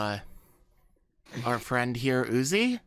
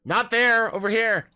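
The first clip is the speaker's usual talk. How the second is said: loud and high, fed up; real annoyance, raise the voice; exasperated shout; 8 semitones higher, 8 dB louder than usual. Real, not acted. Player yells at a friend who moved his token wrong, exasperated shout.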